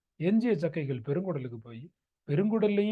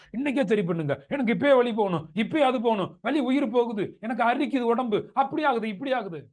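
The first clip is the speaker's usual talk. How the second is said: higher-pitched mock patient pleading; patient's urgent pleas, mimicked; imitating urgent complaints; 5 semitones higher, 3 dB louder than usual. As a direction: pile up a patient's demands, pitched up. Acts out impatient patients' demands.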